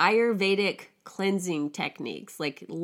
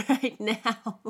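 normal talking voice

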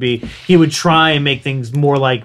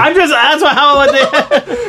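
laughing; 10 semitones higher, slower than usual. Real, not acted. Laughing at pronunciation teasing.

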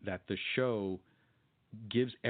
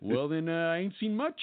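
know-it-all Cliff; 6 semitones higher, 3 dB louder than usual. Retold or imitated. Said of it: "And then Cliff goes".

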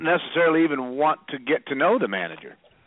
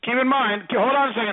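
raised, high-pitched interrupting voice; yelling; 8 semitones higher than usual. Real, not acted. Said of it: "Why are you yelling?".

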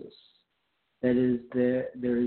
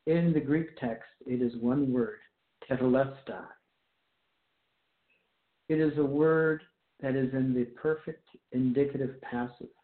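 ordinary talk, nothing marked

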